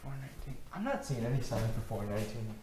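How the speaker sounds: very soft, absent searching murmur; muttering to oneself. Acted. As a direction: murmur under your breath while searching. Lost student reads door numbers.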